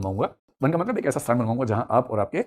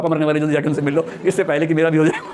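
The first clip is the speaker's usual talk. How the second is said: rushed, higher retelling of a messenger; blurted message; 4 semitones higher, 4 dB louder than usual. Retold or imitated. Messenger to Buddha before he gets converted too, hurried blurting. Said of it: "जाते उसे बोल दिया".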